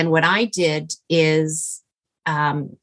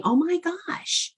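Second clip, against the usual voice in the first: awed exclamation; 12 semitones higher, 6 dB quieter, slower than usual. Retold or imitated.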